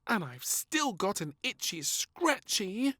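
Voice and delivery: put-on high, slow child's plea; high, slow little girl; little princess voice. A narrator's reading. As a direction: ask sweetly like a little girl.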